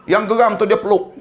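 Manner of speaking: ordinary delivery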